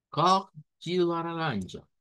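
"Cor de laranja" slow and careful, ko-ji la-RAN-ja; Portuguese pronunciation. Shown as a demonstration. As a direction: repeat slowly for students. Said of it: "Una vez más". ("Cor de laranja" lento y claro)

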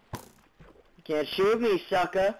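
taunting. Real, not acted. Taunting skeleton.

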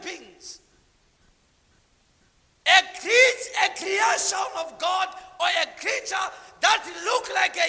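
loud, high fervent sermon; preacher in full flow; full-force preaching. Real, not acted. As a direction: drive the story home to the crowd.

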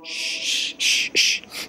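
repeated hissed shh sounds; imitated shushing noise. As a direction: let out a run of shh noises. Imitated noise while retelling.

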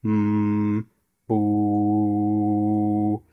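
a low hum, then a deep "boo"; low frequency sounds. Shown as a demonstration. Testing the bass filters, humming and a low "boo". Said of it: "low frequency sounds like".

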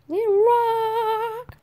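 put-on high, slow drawled Texas accent. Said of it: "it stopped doing the Texas accent".

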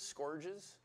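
"scourges" said like "scorges", rising as a question. Shown as a demonstration. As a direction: try "scourges" to rhyme with "gorges", unsure.

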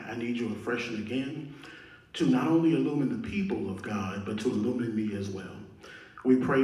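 low, soft, earnest public prayer; opening prayer. Real, not acted. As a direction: pray quietly to God, keeping it low and humble.

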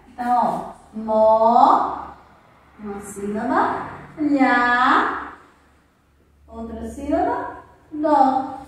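mó, lhá, dó split slowly with pauses; syllables spelled out. Shown as a demonstration. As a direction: break "molhado" into its syllables aloud. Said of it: "O lhá eu não separo".

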